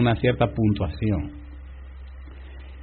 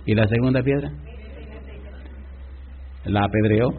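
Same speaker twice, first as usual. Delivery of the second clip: normal talking voice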